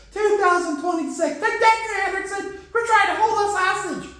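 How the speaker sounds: high-pitched cartoonish crowing; worked-up, high put-on voice; mock defiance. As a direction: protest in a high, silly voice.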